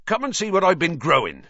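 loud, bright invitation; eager invitation. Acted. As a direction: call them over eagerly.

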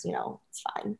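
a wry whispered shrug; whispered aside. Real, not acted. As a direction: whisper it off as a joke. Self-deprecating aside.

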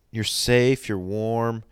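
ordinary talk, nothing marked

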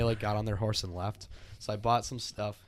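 ordinary talk, nothing marked

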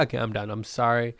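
ordinary talk

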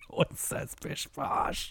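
breathless, high squeak through laughter; helpless laughter. Real, not acted. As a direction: wheeze it out while cracking up. Cracking up at an absurd phrase.

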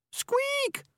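tiny, very high mouse squeal; piercingly high little scream. A narrator's reading. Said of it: "screamed the mouse".

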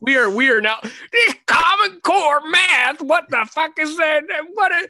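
put-on high, loud cranky old man; old fogey voice. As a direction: squawk like a grumpy old geezer. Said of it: "we are looking like old fogies".

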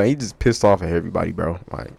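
usual speaking voice

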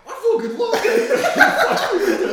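high, loud, slow drawn-out gush; mock gushing fan. Friend impersonating his buddy at a party, gushing very high and slow. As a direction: ham up a fan's over-the-top love. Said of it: "And you were like".

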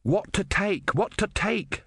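understated narrated shout. A narrator's reading. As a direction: fret it out in a high voice. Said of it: "shouted Ern".

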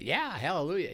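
mild burst of praise; acted rejoicing. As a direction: let out a quick, glad hallelujah. Voices a cheer at God's work.

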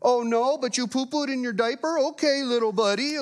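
put-on high playful voice for a little boy; talking to a toddler. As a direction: coo at a toddler son. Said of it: "when I'm talking to my son".

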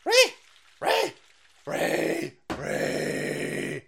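mock screech. Mocking screech sound.

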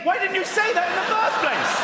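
high, loud mock indignation; exasperated outburst. Lost asker to a tourist stranger, exasperated outburst. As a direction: build to an exasperated high yell.

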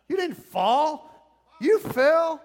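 preacher drives the point home; emphatic correction. Acted. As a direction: hammer home "You didn't fall. You fell". Pastor contrasts fall and fell.

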